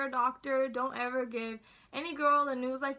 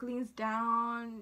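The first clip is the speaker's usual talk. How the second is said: ordinary delivery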